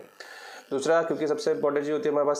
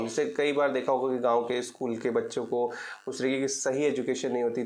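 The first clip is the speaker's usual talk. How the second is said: normal talking voice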